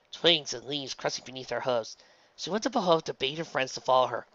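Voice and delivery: usual speaking voice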